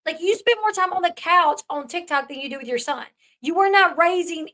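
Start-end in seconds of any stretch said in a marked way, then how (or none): none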